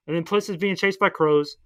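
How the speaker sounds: ordinary delivery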